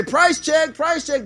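cashier calling out. Retold or imitated. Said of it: "And they say".

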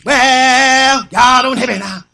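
loud, drawn-out preacher holler; hollering preacher parody. Acted. Preacher working up his congregation, hammed-up hollering. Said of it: "He gonna get there and start going".

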